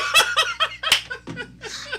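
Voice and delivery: high, loud cackle; long, high-pitched laugh; real laughing fit. Real, not acted. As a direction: crack up helplessly at your own story. Cracking up at own story.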